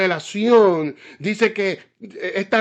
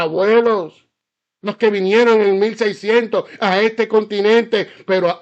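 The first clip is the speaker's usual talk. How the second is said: louder, higher, fiery pulpit preaching; preaching at full force; 4 semitones higher, 5 dB louder than usual. Acted. Pastor rousing the congregation about justice, fiery preaching. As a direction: pound it home from the pulpit like a revival preacher.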